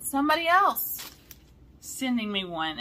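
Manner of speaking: excited news. Retold or imitated. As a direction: blurt the big news. Quoting friend's surprising news.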